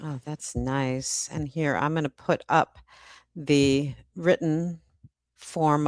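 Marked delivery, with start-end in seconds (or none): none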